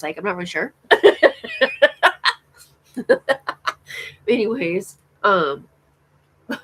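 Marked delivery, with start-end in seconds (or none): 0.9-2.3 a burst of high, loud laughter; loud giggle. Real, not acted. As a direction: crack up at yourself. Laughing at own video.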